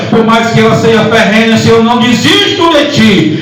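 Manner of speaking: high-pitched, driving sermon delivery; fervent Pentecostal preacher in full cry; full-force preaching. Real, not acted. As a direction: pile up the phrases with rising fervour.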